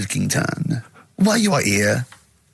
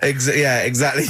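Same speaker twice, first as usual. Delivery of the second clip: ordinary talk, nothing marked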